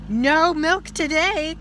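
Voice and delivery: high, loud, slow wail; cried out. Acted. Farmer Brown, upset at the cows' strike, crying out. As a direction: cry it out in dismay. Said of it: "cried Farmer Brown".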